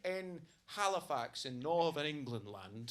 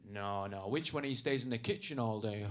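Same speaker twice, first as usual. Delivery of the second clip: lower, a dopey bloke's voice; mock ignorant punter; 7 semitones lower than usual. Comic mimicking a homophobic gig audience, dopey lowered voice. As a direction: ask it like a clueless heckler. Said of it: "They'll be like".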